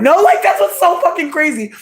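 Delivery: high and loud, fed up; exasperated outburst. Real, not acted. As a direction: burst out at how absurd it is.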